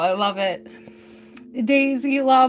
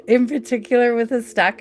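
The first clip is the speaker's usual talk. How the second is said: ordinary talk, nothing marked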